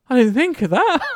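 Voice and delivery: high, loud imitation of a thwarted rival; hammed-up mock protest; mock beaten opponent. Voicing beaten opponent's reply.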